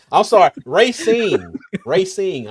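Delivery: laughing apology. Real, not acted. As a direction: stress the g in "Racing" through laughter. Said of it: "I thought you said I'm a big racist fan".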